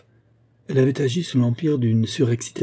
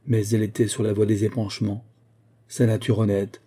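ordinary delivery

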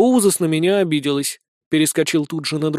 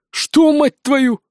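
high, drawn-out outburst; indignant disbelief; 8 semitones higher, 4 dB louder, much slower than usual. Acted. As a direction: blurt it slowly, loudly, appalled. Colonel's shocked outburst at the fairy. (возмущённое изумление)

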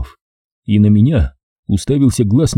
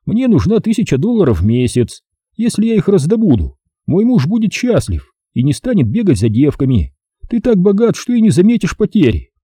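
higher voiced quote of Jenny; quoting Jenny; 8 semitones higher than usual. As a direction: repeat her demand in a higher voice. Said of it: "повторить её слова".

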